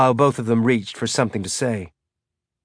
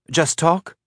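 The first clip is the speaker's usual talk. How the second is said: put-on young boy voice; 6 semitones higher than usual. A narrator's reading. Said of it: "another voice, younger, male, said".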